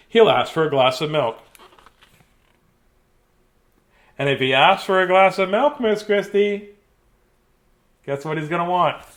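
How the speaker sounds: lively picture book read; reading to children. Acted. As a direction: read it like a bedtime story for kids.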